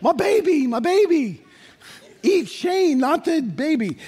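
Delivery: high, pleading cry of a frantic mom; hammed-up mother begging; panicked mother. Wife panics over son.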